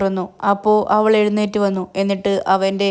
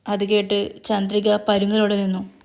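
ordinary delivery

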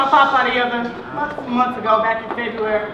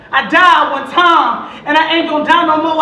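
emphatic refrain; 4 semitones higher, 6 dB louder than usual. Acted. Poet proclaiming papa will die no more, emphatic refrain. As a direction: hit it harder, like a preacher driving it home.

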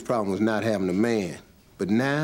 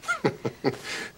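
laugh; 4 semitones higher, 5 dB quieter than usual. Acted. Amused at the scheme.

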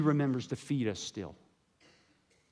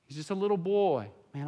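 usual speaking voice

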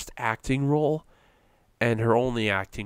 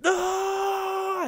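much higher, anguished cry of "No!"; anguished scream. Retold or imitated. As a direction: howl it out like a father losing his daughter. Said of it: "Al Pacino tried to save the scene where he's like".

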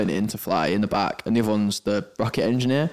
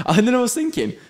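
ordinary talk, nothing marked

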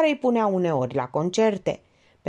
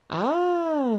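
high drawn-out "ah"; the penny drops; dawning realisation; 9 semitones higher than usual. A narrator's reading. Elena finally understands who meant to kill, dawning realisation. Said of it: "se dumeri Elena".

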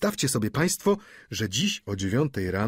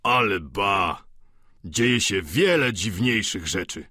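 znaczący okrzyk; 2 semitones lower than usual. A narrator's reading. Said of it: "zawołał Vautrin".

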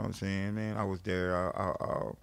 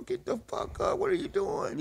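high yelling at a friend asleep; retold yell. Waking his friend passed out in the bathroom, high alarmed yell. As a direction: jolt him awake with a high yell.

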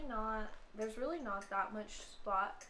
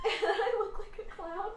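laughing through it, slow and high; 12 semitones higher, 6 dB louder, slower than usual. Real, not acted. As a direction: crack up while repeating it. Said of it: "I paused it right when you said that".

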